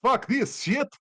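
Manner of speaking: high, loud outburst of a terrified villain; acted frantic exclamation; panicked declaration. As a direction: blurt it out loudly before fleeing, pitch jumping up. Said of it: "And he just declares".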